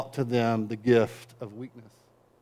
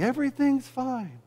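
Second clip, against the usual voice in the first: higher, falsely bright everything-is-fine; mock cheerful, higher and softer; fake cheer; 10 semitones higher, 4 dB quieter than usual. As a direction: chirp it like a proud peacock pretending. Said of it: "with your feathers on display saying".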